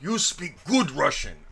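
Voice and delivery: put-on slow, lightly accented compliment, stress on good; imitating coworkers' praise in broken English; mock Russian accent. Coworkers praising the boss, light mock Russian accent. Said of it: "they'd be like".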